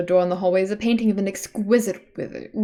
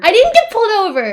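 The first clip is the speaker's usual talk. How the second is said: higher, louder, fed-up insistence; exasperated protest; 11 semitones higher, 9 dB louder than usual. Real, not acted. Streamer protests again to friends teasing her, exasperated. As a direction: protest it one more time.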